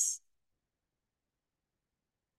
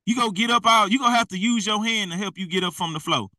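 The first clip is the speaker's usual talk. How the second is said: ordinary delivery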